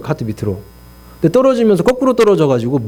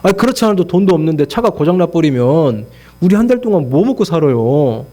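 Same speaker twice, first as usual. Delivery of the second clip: imitating an aggrieved protest; resentful complaint; 2 semitones higher than usual. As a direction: plead and grumble, dragging the last syllables. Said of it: "또다시 원망하는 거예요".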